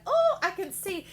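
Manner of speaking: high, loud mocking voice, shooting the idea down; mock dismissive quote. Mimicking the listener brushing off a lover, high and loud. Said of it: "but you're all like".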